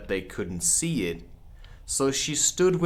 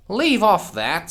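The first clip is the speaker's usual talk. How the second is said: higher, louder scream; screamed Queen; 8 semitones higher, 7 dB louder than usual. Acted. The Queen screams at the bowing gardeners. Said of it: "screamed the Queen".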